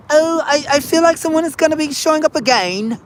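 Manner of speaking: put-on high, lilting old-fashioned voice; old-timey talk. Imitating how folks talked back then, high and lilting. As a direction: mimic the old folks' way of talking. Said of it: "the way they used to talk".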